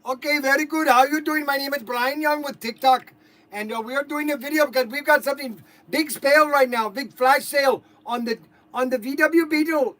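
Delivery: put-on loud, high-pitched Indian-accented sales pitch; put-on Indian accent, hawking a toy car. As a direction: pitch the flash sale in an excited Indian accent. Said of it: "I'm going to do my Indian accent for TikTok".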